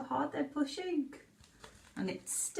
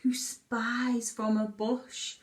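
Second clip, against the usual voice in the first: put-on reading a story to children; 2 semitones lower, slower than usual. Reads picture book aloud.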